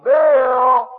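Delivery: loud high call up the stairs. Retold or imitated. Lady calling Bill over the intercom, loud shout. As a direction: yell his name over the intercom.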